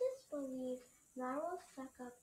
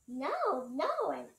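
disgusted; 7 dB louder, slower than usual. Acted. Disgusted character refuses.

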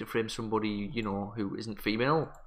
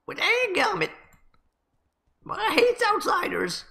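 grumpy villager; 5 dB louder than usual. Acted. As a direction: squeak out a grudge against strangers. Voices the villager Cooter.

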